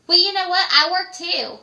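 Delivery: bright nagging caller who woke you; high loud caller voice. Retold or imitated. Friend calling midday, pestering in a high loud voice.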